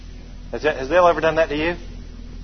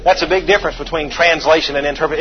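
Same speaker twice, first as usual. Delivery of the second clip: ordinary delivery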